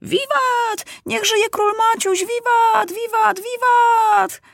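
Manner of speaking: very high-pitched sustained cheers; high, ringing hurrahs; children cheering. A narrator's reading. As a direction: cheer like a crowd of children hailing the king. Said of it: "najgłośniej krzyczą".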